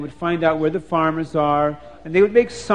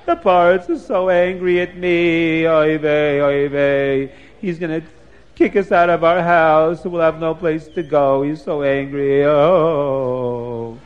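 sing-song lament of a man in trouble; wailing man's voice. Acted. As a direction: moan like a distraught man, stretching the oys. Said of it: "the man's voice says".

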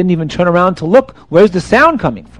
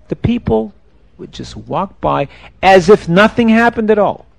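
ordinary delivery, nothing marked

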